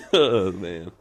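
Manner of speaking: usual speaking voice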